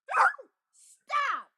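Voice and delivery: sharp high yell. Real, not acted. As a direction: shout stop at the dog. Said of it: "you made me yell at you".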